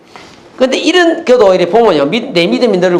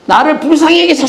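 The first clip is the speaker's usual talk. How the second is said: high-pitched plea, mildly acted; imitated crying out; 9 semitones higher than usual. Blind beggar calling to Jesus, crying out. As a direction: act out a beggar's cry for mercy. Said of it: "소리 질러니까".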